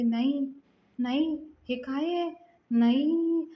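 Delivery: mildly annoyed, rising disbelief. A narrator's reading. Bride upset at the room's decoration, mildly annoyed. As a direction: let the protest climb in pitch. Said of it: "खूप चिडते".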